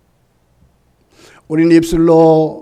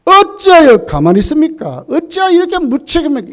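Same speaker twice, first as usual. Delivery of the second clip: complaining; 8 semitones higher than usual. Retold or imitated. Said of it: "원망이".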